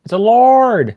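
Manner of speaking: excited shout. Real, not acted. Calling out the card type.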